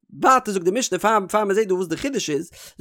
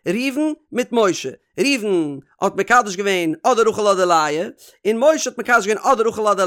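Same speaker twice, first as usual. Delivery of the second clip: slow explaining. Shown as a demonstration. Spelling out the case slowly.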